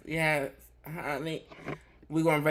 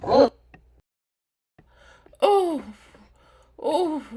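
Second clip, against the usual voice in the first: much higher, louder startled oh; surprised oh; 11 semitones higher, 7 dB louder, slower than usual. Real, not acted. Cohost reacting to the rant, startled high oh. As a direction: gasp out an oh.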